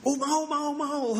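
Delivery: high, thrilled repeated yes; eager yes. Acted. Wife thrilled accepting the angel's golden gift, high eager. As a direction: grab the offer with delight.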